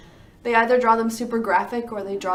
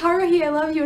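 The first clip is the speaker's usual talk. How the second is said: dramatic love cry; 6 semitones higher, 6 dB louder than usual. Retold or imitated. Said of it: "he was like".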